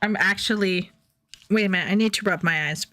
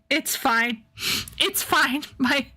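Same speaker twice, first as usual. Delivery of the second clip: normal talking voice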